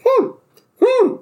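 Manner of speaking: very high, giggly Yoda hums; goofy Yoda. Retold or imitated. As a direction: hum it like a goofy old puppet. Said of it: "he has this cane and he's walking sideways and he's like".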